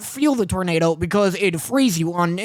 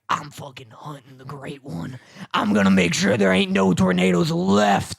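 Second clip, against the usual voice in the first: mock a storm chaser's solemn vow, pitched down; Glenn Powell; 6 semitones lower than usual. Podcaster parodying the movie hero's vow, low mock-dramatic voice. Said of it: "Glenn Powell's out like".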